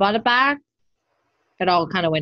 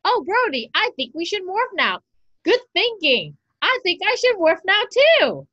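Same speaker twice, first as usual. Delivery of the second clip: higher, hammed-up cheesy hero dialogue; mocking the Ninja Steel rangers' dialogue, hammed-up and higher; mock kid-show heroes; 9 semitones higher than usual. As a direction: parody the obvious lines like a bad kids' show. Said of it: "the stuff that they're stating was the obvious".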